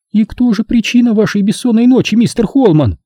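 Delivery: high, indignant feminine sarcasm; venomous. A narrator's reading. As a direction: interrogate him with poisonous politeness. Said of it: "ядовито спросила возмущённая Нина". (ядовито, женским голосом)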